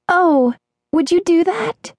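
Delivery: high, slow, delighted gasp of a question; high-pitched drawn-out delight; happy cry. A narrator's reading. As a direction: marvel gratefully in a high voice. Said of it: "cried happily".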